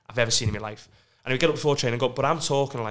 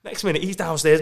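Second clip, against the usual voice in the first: ordinary delivery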